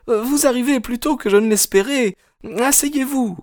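very high, nervously polite voice; high-pitched hostess covering her alarm; flustered young woman. Acted. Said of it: "affolée". (jeune femme affolée, voix très aiguë)

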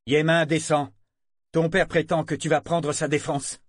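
appel crié plus aigu et plus fort; cri autoritaire. Acted. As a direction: crie un ordre d'en bas. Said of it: "crier d'une voix autoritaire". (cri autoritaire)

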